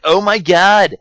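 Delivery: hammed-up thrilled outburst; mock excitement. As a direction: fake delighted squeal about a disaster. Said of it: "And she's excited about it".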